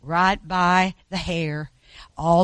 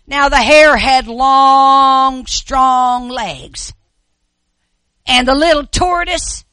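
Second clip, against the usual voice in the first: storytelling; 7 semitones higher, 11 dB louder, slower than usual. A narrator's reading. Story narration in sermon.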